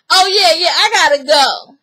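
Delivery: loud, eager acted exit line; hurried brush-off. Performer cutting the talk short for showtime, loud brush-off. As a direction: call it out as if dashing off. Acts out him hurrying off to show.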